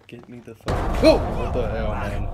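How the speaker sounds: loud frightened shout; panicked yelling. Real, not acted. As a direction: yell out in shock. Jump scare panic.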